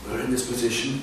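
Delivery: hushed, fearful grumbling; muttering crowd. Acted. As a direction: mutter it under the breath like a frightened crowd. And they were saying.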